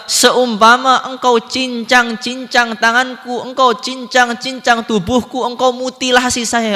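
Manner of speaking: high, insistent declamation; fervent plea. Acted. Preacher voices the saint offering his body to God, high fervent plea.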